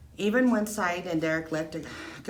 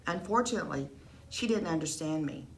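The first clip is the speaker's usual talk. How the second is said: ordinary delivery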